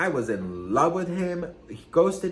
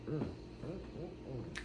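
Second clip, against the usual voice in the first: savoring hum; 16 dB quieter, much slower than usual. Pretend. Savoring new famous boyfriend.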